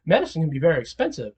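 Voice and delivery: a hint of a careful pharmacist; lightly voiced pharmacist. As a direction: pharmacist gently raising the cost, quietly. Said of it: "the pharmacist will be like".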